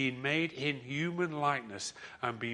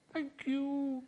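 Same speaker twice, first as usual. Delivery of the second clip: much higher, tiny voice for a fish; high little fish voice; 14 semitones higher than usual. Acted. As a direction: make it a small magical fish piping up. Talking fish thanks the man.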